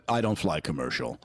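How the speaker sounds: snooty dog voice. Pretend. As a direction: play the dog like a haughty rich pet. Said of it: "Our dogs like".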